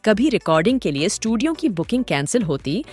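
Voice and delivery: ordinary delivery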